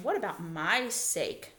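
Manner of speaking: ordinary talk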